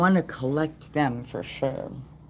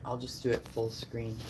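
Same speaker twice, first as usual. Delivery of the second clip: usual speaking voice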